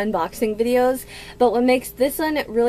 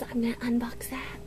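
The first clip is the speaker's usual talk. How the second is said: whisper; 9 dB quieter than usual. Real, not acted. Teasing future unboxing, hushed.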